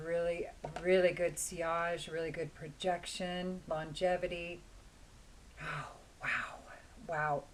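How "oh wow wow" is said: breathy whispered wow; awed whisper. Real, not acted. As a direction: whisper it in awe. Smelling perfume, awed reaction.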